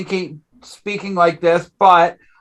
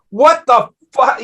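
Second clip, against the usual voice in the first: a quick retold outburst, somewhat raised; retold angry exclamation, lightly raised; 5 semitones higher than usual. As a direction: burst in with a mild mock "what the fuck". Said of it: "I would come and say".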